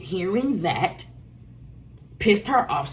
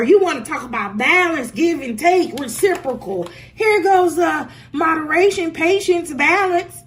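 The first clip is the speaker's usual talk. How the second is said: emphatic shouting; 7 semitones higher, 7 dB louder than usual. Real, not acted. Ranting about the card meanings.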